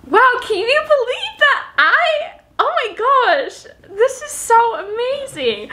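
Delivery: high, breathless-with-delight gushing; overwhelmed excitement. Real, not acted. Vlogger overwhelmed by the Pixel package, excited. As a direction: gush in a high pitch, amazed.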